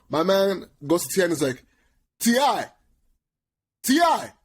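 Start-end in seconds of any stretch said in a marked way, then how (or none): 2.2-4.3 high, loud holler of a name; calling out. Retold or imitated. [name] hailing [name] across the club, calling out high and loud. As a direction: holler to get his attention, pitched way up. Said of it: "So [name] keeps calling".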